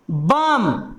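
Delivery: बम said low, ending on M with no B; silent B shown. Shown as a demonstration. Teacher asks how it is read, बम with silent final B. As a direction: drop the pitch and close बम on a plain M. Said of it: "इसमें बी का उच्चारण हुआ?".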